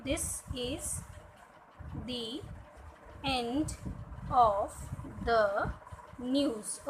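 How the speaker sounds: "This is the end of the news" read word by word with long pauses; slow word-by-word reading. Shown as a demonstration. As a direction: sound out each word slowly, pausing between them. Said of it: "जैसे मैं एक sentence पढ़ रही हूँ".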